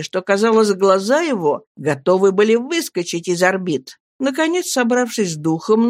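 plain reading voice

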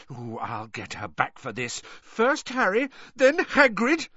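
seething, then climbing high; shaking with rage. A narrator's reading. Hermione vowing to get back at the reporter, enraged. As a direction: build from seething to shrill. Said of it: "shaking with rage".

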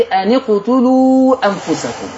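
slow chanted tajweed recitation; Quranic recitation. Shown as a demonstration. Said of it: "الآية ستة وستين عندي". (تلاوة مجودة بطيئة)

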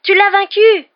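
very high, very loud cries; shrieked, elated triumph; jubilant shouting. A narrator's reading. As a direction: shout the victory while running to a friend. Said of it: "courant vers lui et criant".

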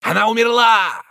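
dramatic Roden. Acted. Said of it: "продолжал Роден".

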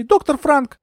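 very high, agitated woman's voice; high-pitched accusation; indignant. A narrator's reading. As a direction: confront them in a high, outraged voice. Indignant heroine confronting the doctor.

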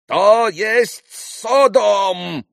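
high, very slow, loud roar. A narrator's reading. Pan outraged by singing next door, roaring. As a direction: roar it out in outrage, stretching the words. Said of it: "взревел вдруг пан Врублевский".

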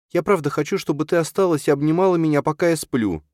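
put-on high, fast little-boy plea; boy begging mum to stay, babyish; babyish child voice. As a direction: plead like a much younger child. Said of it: "Он говорит как маленький".

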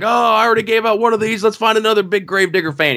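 high, fast and hyped; driver promo. Retold or imitated. Gravedigger driver giving away another plaque, high and quick. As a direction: rush it out to the crowd. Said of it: "and he's like".

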